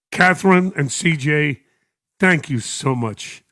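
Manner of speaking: ordinary talk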